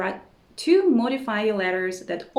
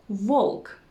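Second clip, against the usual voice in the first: ordinary delivery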